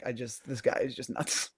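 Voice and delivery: soft, exasperated head-shake; a wife quietly giving up on her husband; muttering wife. Pretend. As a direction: say it under your breath, fed up. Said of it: "shaking her head like".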